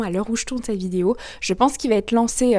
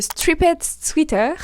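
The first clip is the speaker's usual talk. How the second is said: slow, higher-pitched French-accented English; self-mocked English accent; 5 semitones higher, much slower than usual. Real, not acted. Vlogger presenting a crochet sweater pattern, self-mocked English accent. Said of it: "avec mon super accent".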